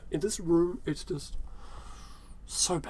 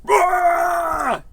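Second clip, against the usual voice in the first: sudden high, louder jump-scare "ah!"; mock scare shout; 12 semitones higher, 4 dB louder than usual. Shown as a demonstration. Demonstrating scaring a child, sudden jump-scare shout. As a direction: leap out and yell to scare a kid. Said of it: "Leap out dressed up as".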